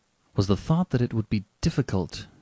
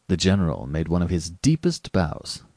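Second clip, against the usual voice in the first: ordinary delivery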